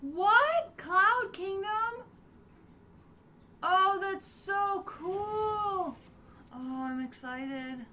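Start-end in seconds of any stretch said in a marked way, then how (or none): 0.0-0.8 high, loud startled rise; shocked what. Real, not acted. Gamer startled by a sudden level change, loud shocked. 0.8-6.0 awed delight. Real, not acted. Arriving in new kingdom.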